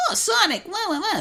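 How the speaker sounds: whiny, very high loud falsetto; Tails. Shown as a demonstration. Podcaster contrasting old Tails voices, whiny high take. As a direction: squeal it like an early whiny cartoon sidekick. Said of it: "It's less whiny".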